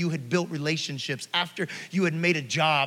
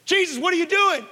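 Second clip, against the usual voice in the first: high, louder shocked cry; alarmed onlooker; 10 semitones higher, 4 dB louder than usual. Acted. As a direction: gasp it out as a horrified bystander.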